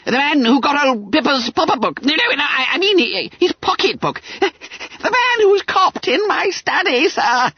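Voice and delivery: high, stammering squeal; babbling with terror. A narrator's reading. Frightened pupil explaining the pickpocket to schoolmaster, babbling. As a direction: fumble and correct words in a fluster. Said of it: "He was fairly babbling with terror".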